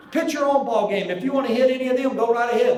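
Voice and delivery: a teammate hollering to back up the pitcher; loud defiant shout. Retold or imitated. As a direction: bark it across the field at the other team. Rose yelling at dugout.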